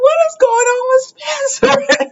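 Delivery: very high, quick incredulous question; squeaky, amused bewilderment; baffled reaction. Retold or imitated. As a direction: shoot the voice way up in disbelief. Her baffled reaction to Spencer leaving.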